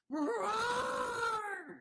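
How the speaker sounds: high roaring cry, straining up; mock roar. Retelling roaring at his client mid-meeting, a high roaring cry. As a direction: let out a lion's roar at the table. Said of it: "Way louder than that".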